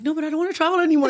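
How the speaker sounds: high, rushed mild protest; lightly acted whiny objection; mock protest. Retold protest to bosses.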